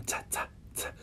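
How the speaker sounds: "tsa, tsa, tsa," as whispered, breathy "t" bursts; aspirated t puffs. Shown as a demonstration. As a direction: puff air out on each "tsa". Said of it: "ele ainda tem um soprinho".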